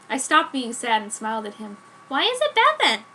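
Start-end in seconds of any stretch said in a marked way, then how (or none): 2.1-3.0 put-on high, bright girlish voice for the heroine; Joan's voice. Teen girl pleading with her vampire boyfriend, pitched up.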